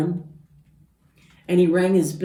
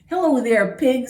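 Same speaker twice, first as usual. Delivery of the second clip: high, louder duck greeting; cheery Duck; 8 semitones higher, 7 dB louder than usual. Acted. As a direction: sing out hello as Duck. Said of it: "said Duck".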